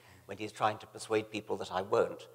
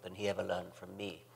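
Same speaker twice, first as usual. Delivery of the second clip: ordinary talk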